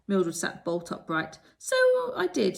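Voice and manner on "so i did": high, loud exclamation; exclaiming. A narrator's reading. Mildred sitting bolt upright, realizing: high loud exclamation. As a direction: burst out, suddenly awake. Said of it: "she exclaimed".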